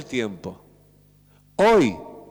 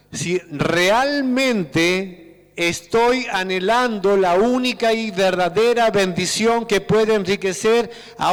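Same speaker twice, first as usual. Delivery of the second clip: higher, drawn-out preaching about blessing; impassioned preaching; 4 semitones higher, slower than usual. Acted. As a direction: stretch the words like a fervent sermon peak. Preaches at full force.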